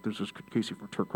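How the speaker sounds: garbled, broken-up headset mumble; bad phone connection. Shown as a demonstration. Demonstrating a bad headset connection to listeners, garbled. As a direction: imitate a crackly line chopping up the words. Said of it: "sometimes I sound like this".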